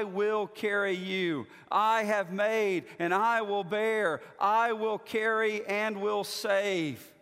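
slow, measured reading of scripture. Acted. Pastor reading scripture to the congregation, slow and measured. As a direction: read the passage slowly, weighing each line.

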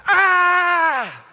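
put-on high, loud baby scream; imitating his daughter's angry squeal; toddler's outraged shriek. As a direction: shriek like a toddler losing her snack. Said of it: "She says".

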